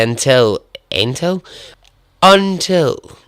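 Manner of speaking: rising posh sign-off voice; lightly voiced posh farewell. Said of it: "that was my, like, posh voice".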